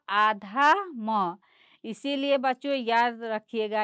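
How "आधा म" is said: आधा म stretched out very slowly; drawn-out answer. Shown as a demonstration. Teacher stresses the answer आधा म for the children. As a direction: spell out आधा म word by word. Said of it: "इसमें क्या हो गया?".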